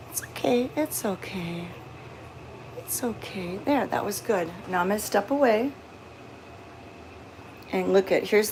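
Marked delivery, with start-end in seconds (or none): 0.1-3.6 softer and softer reassurance; calm the cat, fading gently; reassuring. Real, not acted. Calming the cat.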